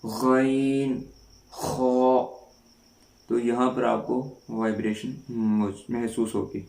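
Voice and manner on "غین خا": ghain and kha from the throat; throat letters shown slowly; letter demo. Shown as a demonstration. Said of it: "تو آپ پڑھیں".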